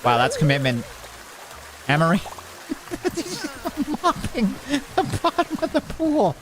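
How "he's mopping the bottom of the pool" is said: high, giggling through the words; laughing at the janitor mopping the pool bottom. Real, not acted. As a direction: laugh it out in disbelief.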